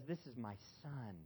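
low and soft, a father's gentle blessing; tender father's voice. Acted. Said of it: "And the Father says".

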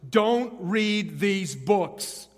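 loud, very slow, booming warning; loud, drawn-out mock proclamation; block-letter warning. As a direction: spell it out like a giant headline ad. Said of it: "literally with big block letters".

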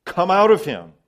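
loud, forceful order; strong imperative. Acted. Preacher quotes Jesus casting out a demon, as a strong command. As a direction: command it out with full authority. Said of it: "This is a strong statement".

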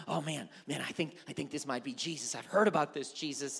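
low, soft thinking aloud; murmured musing. Acted. Blind beggar guessing it might be Jesus, quietly musing.

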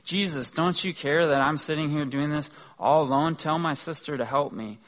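hammed-up annoyed plea for help; exasperated complaint. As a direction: whine at Jesus like a fed-up sister. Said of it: "and she gets mad. She's like".

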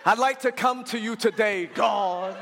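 drawn-out, solemn church prayer parody; mock Baptist prayer. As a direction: imitate a churchy Baptist praying, stretching the last word. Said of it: "the only way you know how to pray is Baptist".

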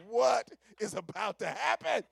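loud, slow alarmed question; hammed-up panic, loud and drawn out; alarmed outburst. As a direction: cry out in mock dread. Retelling his alarm at the chair.

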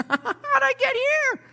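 very high, slow mock lament; bewildered wail. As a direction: squeal it slowly in falsetto. Person far from God, bewildered.

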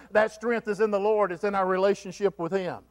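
ordinary talk, nothing marked

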